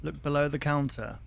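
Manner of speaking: ordinary delivery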